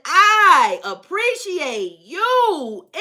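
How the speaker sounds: very slow, stretched singsong; long swooping "I appreciate you"; drawn-out appreciation. Retold or imitated. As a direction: linger lavishly on each word. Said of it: "That baby said".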